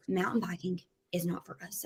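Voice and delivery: soft whisper, almost no voice; whispered verdict. Retold or imitated. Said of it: "he goes".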